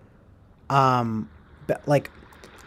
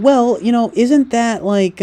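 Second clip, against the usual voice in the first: higher, louder, slow imitation of mom; a hint of mom, pitched up; mom's voice; 8 semitones higher, 4 dB louder than usual. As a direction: lightly mimic mom's reasoning tone. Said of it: "my mom was sort of saying".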